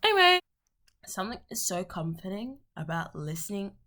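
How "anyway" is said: sudden loud high "Anyway"; loud exclaim. Real, not acted.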